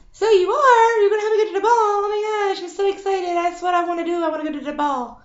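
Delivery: high, thrilled squeal; a pretend princess overjoyed at the news; excited Cinderella. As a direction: gush with pretend delight. Cinderella thrilled about the ball.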